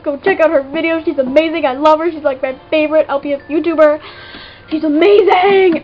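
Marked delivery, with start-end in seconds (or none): 4.7-5.8 gushing. Real, not acted. Gushing over favorite YouTuber.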